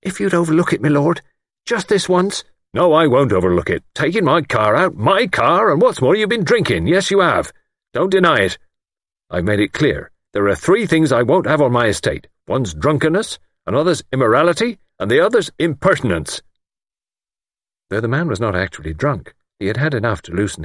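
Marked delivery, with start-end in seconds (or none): 0.0-2.4 high pleading voice; pleading servant. A narrator's reading. Chauffeur pleads with angry employer. 2.7-16.4 high, indignant lord's tirade; angry rebuke. A narrator's reading. Lord angrily rebukes fired chauffeur.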